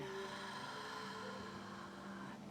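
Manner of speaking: slow audible breath out through the mouth; long exhale sigh. Shown as a demonstration. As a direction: demonstrate a long deep exhale. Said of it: "exhale out".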